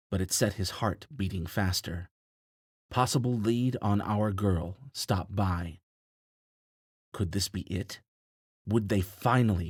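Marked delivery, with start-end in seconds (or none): none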